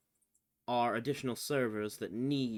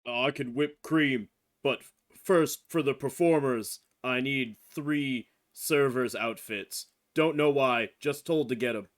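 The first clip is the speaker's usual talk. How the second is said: much louder answer over kitchen noise; loud servant reply; 9 dB louder than usual. Acted. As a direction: speak up over the din of the kitchen. Character answers the chef loudly.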